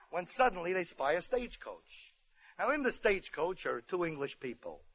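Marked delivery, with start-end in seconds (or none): none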